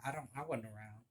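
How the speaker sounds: usual speaking voice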